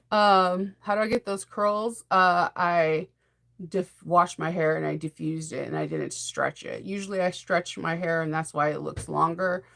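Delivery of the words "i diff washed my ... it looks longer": ordinary talk, nothing marked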